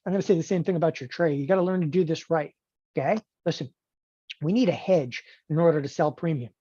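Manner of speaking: ordinary talk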